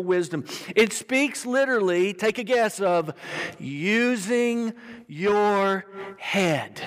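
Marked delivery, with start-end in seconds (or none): 3.6-6.8 very slow, each word drawn out; slow, weighty reveal; deliberate key phrase. Real, not acted. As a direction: stretch it out word by word. Drives home the key phrase.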